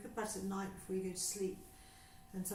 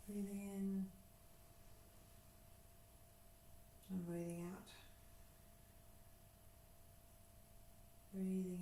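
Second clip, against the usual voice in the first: guided breathing; 4 dB quieter than usual. Acted. Teacher guiding a class through breathing, calm and unhurried. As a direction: talk them gently through each breath.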